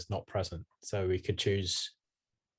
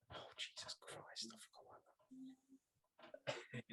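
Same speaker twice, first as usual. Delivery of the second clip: 1 under his breath; 26 dB quieter, slower than usual. Real, not acted. Dreading saying a long name.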